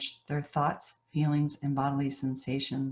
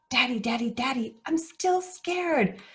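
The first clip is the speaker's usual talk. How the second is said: put-on still-scared little boy; 9 semitones higher than usual. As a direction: drag it out like a frightened toddler.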